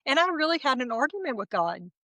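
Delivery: ordinary delivery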